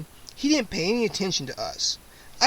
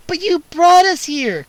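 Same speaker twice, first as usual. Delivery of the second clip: put-on much higher, loud angry girl's protest; girl protesting angrily; 11 semitones higher, 9 dB louder than usual. A narrator's reading. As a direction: accuse him with high indignation. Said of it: "Kara protested angrily".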